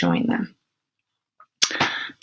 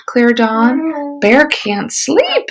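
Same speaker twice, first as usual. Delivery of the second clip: usual speaking voice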